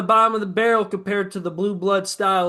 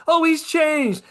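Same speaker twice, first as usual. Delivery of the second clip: higher, louder sarcastic mimic; sneering higher-pitched impression of believers; mocking quote; 8 semitones higher, 4 dB louder, much slower than usual. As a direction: mimic the gullible fans, higher. Mocking people who defend Kiffin.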